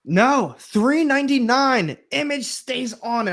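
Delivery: high, emphatic exclamation over a bargain; excited, high-pitched outburst; emphatic amazement. Real, not acted. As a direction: start loud and soar high, marveling at the price. Exclaiming over a book's low price.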